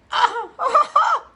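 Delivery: a sudden high burst of laughter; loud laugh. Real, not acted. Laughs at her own joke.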